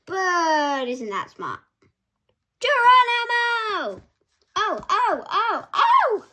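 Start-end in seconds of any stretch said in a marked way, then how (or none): 2.6-4.0 high, loud battle cry as he leaps; whooping Geronimo shout; jumping shout. Acted. Alpaca diving into the lake. 4.6-6.3 startled yelps. Acted. Alpaca bitten by piranhas.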